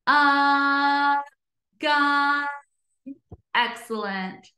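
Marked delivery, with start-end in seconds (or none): 0.1-2.5 long level "Uh", then drawn-out "gun"; stretched short U. Shown as a demonstration. Teacher showing the short U sound, stretched vowel. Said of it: "I hear a short U sound".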